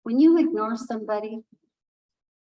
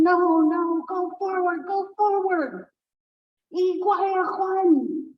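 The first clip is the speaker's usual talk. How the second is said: high, loud urging shout; urgent calling; 8 semitones higher, 3 dB louder, slower than usual. Acted. Raven shouting to Hawk to keep flying, urgent. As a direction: call him onward with force. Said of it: "and Raven's going".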